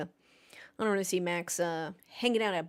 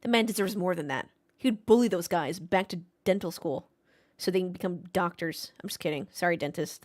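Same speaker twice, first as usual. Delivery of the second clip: ordinary talk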